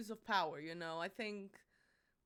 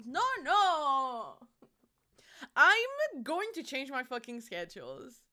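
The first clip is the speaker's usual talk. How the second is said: hammed-up threat at chat; mock fury; 6 dB louder, slower than usual. Streamer hams up a loud threat at chat over six seven.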